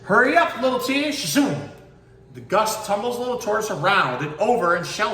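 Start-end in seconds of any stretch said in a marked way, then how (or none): 0.0-1.7 high loud zooming tease; cheetah zooming. Acted. Cheetah rushes past little tortoise, high loud tease. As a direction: whip past with a taunt.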